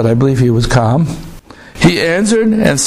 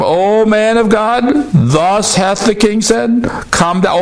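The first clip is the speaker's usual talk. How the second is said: slow, lofty summons; a captain demanding the prophet come down; commanding captain; 6 semitones higher, slower than usual. Acted. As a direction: call him down with authority.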